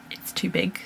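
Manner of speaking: hushed whisper, a little dismayed. Real, not acted. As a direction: whisper it like a secret letdown. Telling the ring didn't fit.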